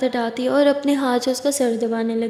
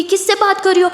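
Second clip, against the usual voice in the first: much higher, heated question; angry mocking echo; 7 semitones higher, 4 dB louder than usual. Aditi echoing Kiran's questioning, angrily. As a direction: fling the question back indignantly.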